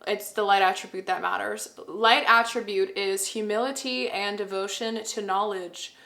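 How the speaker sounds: normal talking voice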